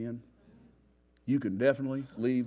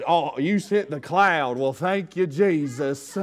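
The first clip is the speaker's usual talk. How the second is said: loud, slow, higher grateful sigh of relief; relieved wanderer; 5 semitones higher, 11 dB louder, slower than usual. Retold or imitated. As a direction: thank Jesus like shade finally came. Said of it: "And he just".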